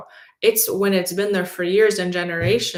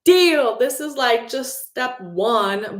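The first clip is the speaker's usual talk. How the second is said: much higher, slow, amazed and delighted; thrilled celebration; 7 semitones higher, 4 dB louder, slower than usual. Retold or imitated. Teacher thrilled the student didn't fight back, delighted gush. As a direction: gasp then gush with pride. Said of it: "celebrating that kid so much".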